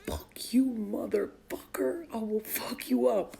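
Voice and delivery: slow, drawn-out threat read aloud; daughter's texted threat. Retold or imitated. Mother reading out her daughter's reply, slow mock threat. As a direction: imitate a kid's deliberate, word-by-word threat. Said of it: "So my daughter responds".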